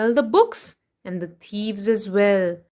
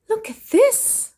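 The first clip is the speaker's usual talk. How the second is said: high, wide-eyed exclaim; amazed; 10 semitones higher than usual. Acted. Storyteller showing children the library, high and amazed. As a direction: point it out in wonder.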